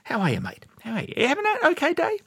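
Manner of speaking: light mock-chatty officer voice, pitch climbing up high; lightly hammed-up chatty officer; overly polite officer. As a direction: imitate a too-casual polite commander. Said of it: "Tom Hanks went up to one of the men in his charge and said".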